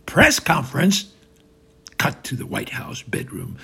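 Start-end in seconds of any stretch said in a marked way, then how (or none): 0.1-1.1 loud, alarmed question; panicking. Acted. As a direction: blurt it back in alarm, voice rising. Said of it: "Trump, panicking".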